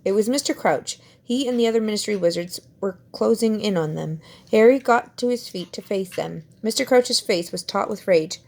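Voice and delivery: ordinary delivery, nothing marked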